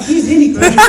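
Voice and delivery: high, loud laughing report; Mario voice. Retold or imitated. Mario relaying to the child, a high laughing voice. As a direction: tell the kid with a laugh. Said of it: "and they say".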